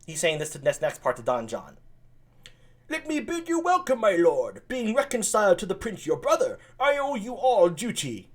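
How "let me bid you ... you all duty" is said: much higher, courtly; Leonato welcoming. Acted. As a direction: bid a lord welcome. Leonato greets Don John.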